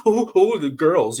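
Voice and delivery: high, loud flustered yelp; flustered boy. Retold or imitated. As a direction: gush like a flustered teen boy. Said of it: "he was all like".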